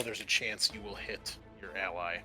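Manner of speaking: ordinary delivery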